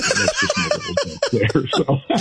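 chuckling through the joke; laughing. Real, not acted. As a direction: laugh while you say it. Joking about throwing the gun.

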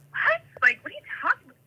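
high-pitched mock disbelief; imitated incredulous man; taken aback. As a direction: react baffled and affronted. Offended man, retold.

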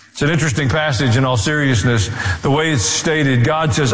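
usual speaking voice

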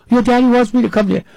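high, loud raspy Cotton impression; imitating Cotton. Storyteller mimicking Cotton, amazed at the dinner invite, high and loud. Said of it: "He goes".

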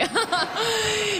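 high burst of laughter. Real, not acted. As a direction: let out a high cackle. Laughs at own joke.

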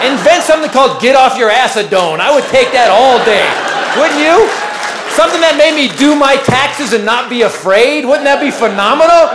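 shouting enthusiastically; loud rant. Real, not acted. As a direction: build to a loud comic rant.

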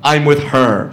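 put-on low gloating boyfriend comeback; smug comeback. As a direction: answer like you've already won. Comeback to heckler.

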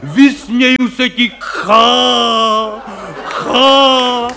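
a stage hero roaring his defiance at death; heroic roar. Retold or imitated. Actor on stage roaring defiance at the wind, hammed-up heroic yell. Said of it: "prostě řvou".

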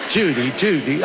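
quick impression of an old film star; Cary Grant. Preacher joking about his failed English accent, film-star impression. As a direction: do a classic movie actor catchphrase. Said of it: "I sound more like Cary Grant".

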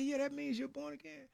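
high, very soft and gentle; the Lord speaking. Retold or imitated. The Lord comforting the crying inmate, soft and high. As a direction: speak it softly, like a father to a crying son. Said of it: "He said".

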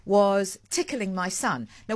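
normal talking voice

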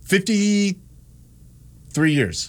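stretched very slow and high; slow, high-pitched insistence on the length of time; drawn-out emphasis. Real, not acted. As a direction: draw the number out for emphasis. Emphasising how long he's lived there.

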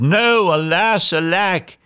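loud, high, melodramatic wail; hammed-up protest, pitched high; anguished cry. A narrator's reading. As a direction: cry out like a despairing prince. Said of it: "The prince cried".

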